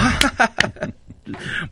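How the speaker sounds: airy, high laugh at the joke; high breathy laugh. Real, not acted.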